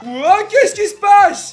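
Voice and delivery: very high, drawn-out amazement; hammed-up wail of astonishment; talking desk. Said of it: "Il y a le bureau qui va faire waouh".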